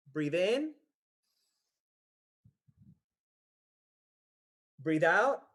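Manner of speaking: calm spaced-out breathing prompts; paced breathing cue. Shown as a demonstration. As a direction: lead the breath with long pauses.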